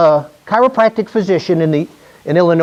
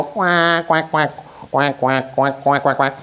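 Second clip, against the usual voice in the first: soft mock duck quacks; playful duck imitation, quietly; quacking back; 3 semitones lower, 4 dB quieter, slower than usual. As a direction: quack like a duck, teasing them. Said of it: "Now I go".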